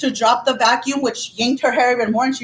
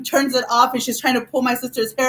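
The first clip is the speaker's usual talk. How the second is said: ordinary talk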